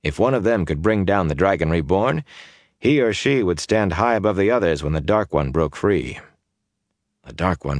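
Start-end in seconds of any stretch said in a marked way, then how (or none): none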